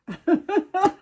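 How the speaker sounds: high, real burst of laughter; laughing. Real, not acted. Host amused by her own accent, laughing. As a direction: crack up in a high giggle.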